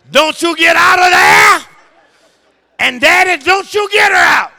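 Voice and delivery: high, booming shouted decree; retold stern command. Preacher reminds congregation of wife's word, shouted decree. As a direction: thunder the order like law from on high. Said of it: "The word was".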